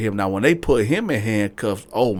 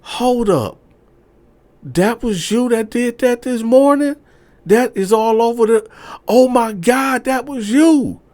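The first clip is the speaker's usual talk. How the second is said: much higher and louder, building amazement; shocked realization; 7 dB louder than usual. Retold or imitated. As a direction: piece it together in disbelief. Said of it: "So she was like".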